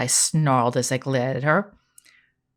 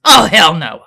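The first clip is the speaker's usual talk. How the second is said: loud, firm hell no; growled refusal; 3 semitones higher, 10 dB louder than usual. Acted. Jill refuses to call her uncle, a loud growl. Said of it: "I growled".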